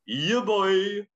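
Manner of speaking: high, loud, drawn-out hype call; hyped announcement. Acted. Host shouts the challenge name to kick off the explanation, hyped. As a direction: belt out the title like a hype man.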